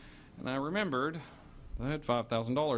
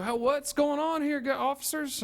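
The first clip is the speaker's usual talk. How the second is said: high mock voice; 12 semitones higher, 4 dB louder than usual. [name]'s high voice to police.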